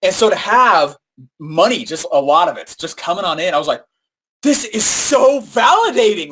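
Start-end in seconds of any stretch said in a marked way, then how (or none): 4.4-6.3 thrilled. Retold or imitated. Retelling his thrill.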